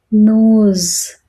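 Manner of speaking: ordinary delivery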